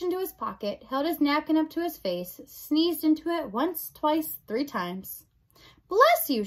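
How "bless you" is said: high, loud blessing; hollered, pitch jumping up; shouted. Acted. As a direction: shout it brightly after a sneeze. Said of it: "shouted Meg".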